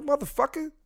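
high-pitched exasperated yell at someone outside; re-enacting his high-pitched holler; retold yell. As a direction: yell it high, as if through the window. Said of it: "And I yelled at him".

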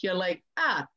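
ordinary talk

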